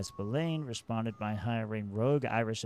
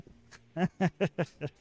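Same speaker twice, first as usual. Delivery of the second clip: loud laugh; 9 semitones higher, 8 dB louder than usual. Real, not acted. Laughs at his own mobster line.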